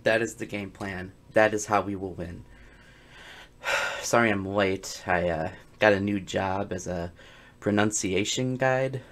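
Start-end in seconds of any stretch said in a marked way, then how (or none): none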